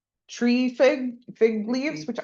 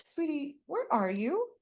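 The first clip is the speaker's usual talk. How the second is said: softer, higher tender call; 6 semitones higher, 6 dB quieter than usual. Retold or imitated. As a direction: call gently to a hiding child. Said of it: "it's the soft tone".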